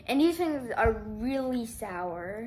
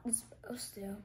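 whispering; 13 dB quieter than usual. Real, not acted. Aside while eating candy.

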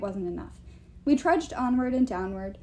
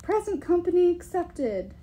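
voice from the back; 7 semitones higher, 3 dB louder than usual. A narrator's reading. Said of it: "Someone in the back said".

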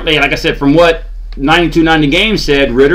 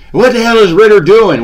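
higher, louder mocking voice of a fed-up fan; mocking fan quote; 5 semitones higher, 3 dB louder than usual. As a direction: mimic an annoyed Twitter fan, pitched up. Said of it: "people were talking on Twitter".

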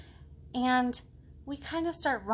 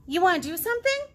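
higher and louder, eager; eager kid; 8 semitones higher, 7 dB louder, faster than usual. Acted. Girl in the book asking the potato to play, high. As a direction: offer it like a keen kid. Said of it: "girl talks to potato".

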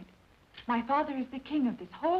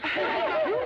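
bright burst of laughter; high laugh; 13 semitones higher, 4 dB louder than usual. Real, not acted. Laughing at hole in pants.

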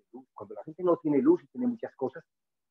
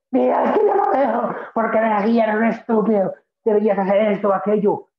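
shouted insult, much higher and louder; angry outburst; 9 semitones higher, 9 dB louder than usual. Retold or imitated. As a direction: hurl it at them in anger. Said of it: "cuando estás enojado".

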